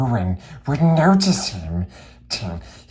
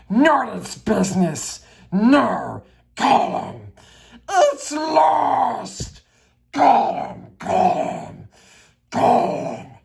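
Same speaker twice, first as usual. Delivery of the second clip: much higher, gulping creature cry; Gollum wailing; 12 semitones higher, 4 dB louder, slower than usual. Acted. Gollum grieves the ring, wailing and gulping. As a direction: wail and swallow in a creature voice.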